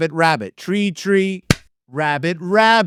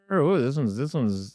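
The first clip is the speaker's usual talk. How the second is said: normal talking voice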